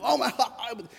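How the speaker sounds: high, laughing "Oh my God"; laughing coworkers. Retold or imitated. Coworkers mocking the new guy, laughing high. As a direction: crack up while saying it. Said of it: "They go".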